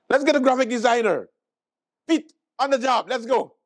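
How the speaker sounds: high, eager rallying voice; rally the team in mock enthusiasm; mock excited agreement. Comedian as staff rushing to hire a designer, mock excited. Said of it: "And everybody like".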